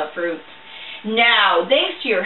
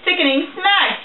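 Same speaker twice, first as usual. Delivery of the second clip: usual speaking voice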